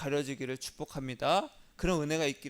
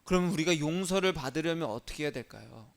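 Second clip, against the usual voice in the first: ordinary talk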